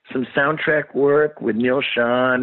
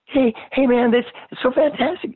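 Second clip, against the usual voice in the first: way higher, gushing starstruck voice; nerdy excited fan. Retold or imitated. Starstruck singer gushing on tape to Eddie, in a much higher voice. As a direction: gush like a dorky fan on the phone. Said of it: "You can hear me going".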